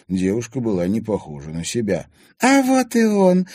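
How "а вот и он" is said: high, loud, drawn-out accusing cry; shouting. A narrator's reading. Anfisa spotting the accused stepson, shouting. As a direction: cry it out slowly and shrilly. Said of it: "крикнула Анфиса".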